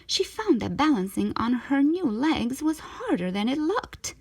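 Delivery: ordinary delivery, nothing marked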